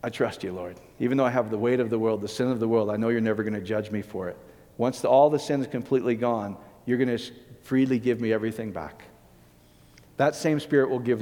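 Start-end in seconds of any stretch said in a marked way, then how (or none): 0.0-9.0 softly, calmly, like a quiet prayer; low and hushed, fully trusting. Retold or imitated. Jesus calmly tells the Lord he trusts him, hushed and serene. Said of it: "Jesus said calmly".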